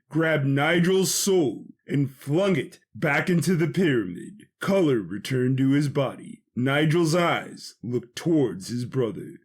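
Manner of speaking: ordinary delivery, nothing marked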